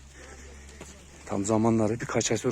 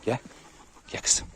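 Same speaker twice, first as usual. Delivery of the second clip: ordinary delivery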